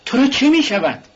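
a sudden high-pitched shout of alarm. A narrator's reading. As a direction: yell it at him in alarm. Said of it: "رازومیخین فریاد زد".

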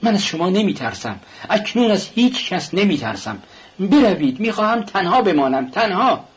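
angry cry to be left alone. A narrator's reading. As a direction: drive them out, voice rising. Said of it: "راسکولنیکوف با عصبانیت گفت".

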